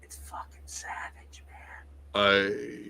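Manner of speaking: hushed whisper full of awe; awed whisper. Real, not acted. Telling friends about the unreleased album, awed whisper. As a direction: breathe it like a secret treasure.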